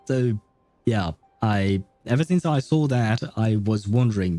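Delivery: normal talking voice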